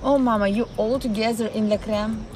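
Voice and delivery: ordinary delivery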